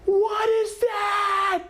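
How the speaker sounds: high, drawn-out screamed question; re-enacted scream. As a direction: scream each word slowly, way up high. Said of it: "I mean, I'm screaming".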